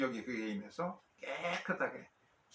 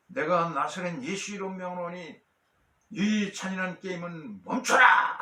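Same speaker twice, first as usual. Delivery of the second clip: shouted command; 5 semitones higher, 9 dB louder than usual. Acted. Telemachus crying out to stop the gladiators' game, shouted command. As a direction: call out a command over a crowd, building to a long final shout. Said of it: "고함을 쳤습니다".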